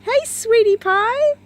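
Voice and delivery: very high, loud, slow cooing; pet voice. Real, not acted. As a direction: coo at the dog, drawn out. Greeting the dog fondly.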